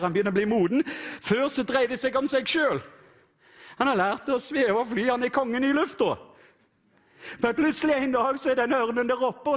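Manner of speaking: high, driving preacher's voice; preaching at full force. Acted. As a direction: preach the eagle story with fervour. Preaches eagle story.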